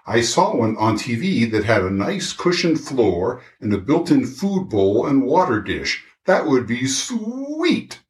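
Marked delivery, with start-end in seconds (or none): 6.3-8.0 high and slow, savoring it; dreamy. Acted. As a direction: linger dreamily over the thought. Dog dreams of bike trailer.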